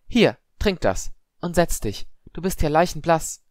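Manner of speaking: high, slow, firm fussing; concerned older student. A narrator's reading. Clearwater handing Padma water, concerned and insistent. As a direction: take charge of someone in shock.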